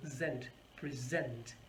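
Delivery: zent alone, then present as pre-ZENT, slowly; second-syllable stress. Shown as a demonstration. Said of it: "The stress is on the second syllable".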